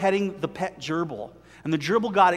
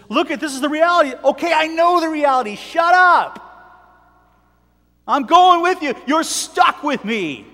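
high, loud and insistent, slowing at the end; defiant Ruth; 9 semitones higher, 8 dB louder than usual. Acted. Ruth cuts Naomi off, refusing to leave, loud defiance. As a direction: shout down her objections and dig in.

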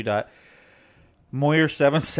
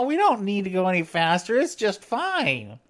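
high-pitched caricature of a wife; mock your wife in a high voice, stretching the ending; mocking wife's voice; 8 semitones higher than usual. Husband mimics wife refusing faster technology, high mocking voice. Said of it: "didn't mean to mock your voice".

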